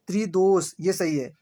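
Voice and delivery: ordinary delivery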